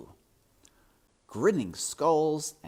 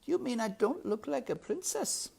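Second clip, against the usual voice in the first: hurt. A narrator's reading. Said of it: "blinking back tears".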